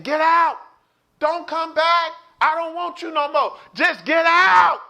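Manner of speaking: high, loud ordering-out; imitated angry husband. Retelling husband telling wife to get out, acted angry yelling. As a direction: throw the wife out, last words stretched loud. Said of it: "he was angry".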